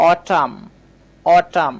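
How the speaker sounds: "অটাম" twice, opening on a clear "aw"; careful model of "অটাম" with "অ" at the start; pronunciation demonstration. Shown as a demonstration. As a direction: say "অটাম" slowly for learners, pausing between repeats. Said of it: "এটা লিখেছি".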